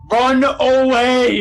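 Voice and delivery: drawn-out high, loud yell. Acted. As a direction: holler it slowly across the room at someone. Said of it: "he's, like, yelling at you".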